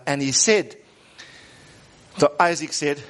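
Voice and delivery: ordinary delivery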